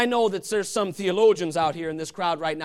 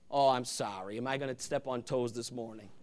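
mock whine; 9 semitones lower, 10 dB quieter than usual. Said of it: "how much we whine as Christians".

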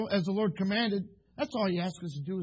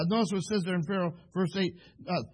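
usual speaking voice

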